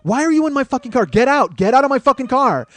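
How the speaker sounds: loud, high angry demand; angry yell. Retold or imitated. Shouting at the woman to get out of his car, high and loud. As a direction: bark at her to get out. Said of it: "I'm like".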